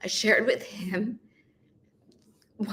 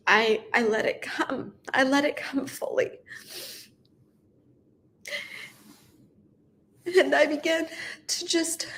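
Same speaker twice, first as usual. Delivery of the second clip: high voice, fighting back real tears; choked up and halting; tearful; 6 semitones higher than usual. Real, not acted. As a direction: push on through crying, with long pauses. Crying on video, handed comfort, continues.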